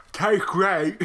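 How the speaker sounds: high, gummy toothless voice; mushy mock toothless cheer; toothless speaker. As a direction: say it as if all teeth are gone.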